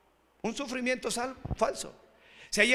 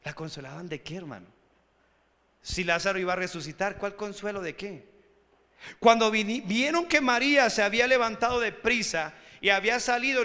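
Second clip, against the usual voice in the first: ordinary talk, nothing marked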